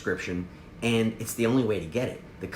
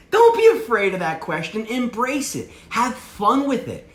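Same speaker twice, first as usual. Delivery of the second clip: laughing; 9 semitones higher, 6 dB louder, slower than usual. Real, not acted. Coach urging the audience through laughter, laughing. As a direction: still laughing, higher and louder.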